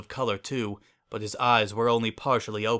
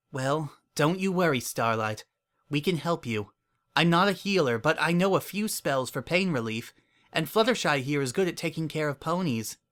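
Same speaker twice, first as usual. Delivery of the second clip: gentle, higher mare voice; reassuring; 6 semitones higher than usual. Acted. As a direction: reassure him kindly. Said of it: "continued to smile at him".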